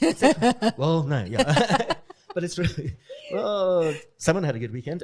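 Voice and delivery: laughing while teasing. Real, not acted. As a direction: giggle through the words. Laughing at partner's weekend.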